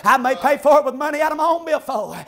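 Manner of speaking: ordinary talk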